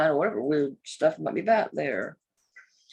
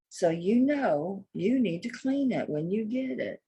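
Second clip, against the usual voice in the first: usual speaking voice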